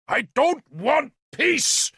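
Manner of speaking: slow, high shouted refusal; shouting. A narrator's reading. King refusing Galahad's plea, shouting. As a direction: throw the word peace back at him. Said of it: "Gorfethid shouted at Galahad".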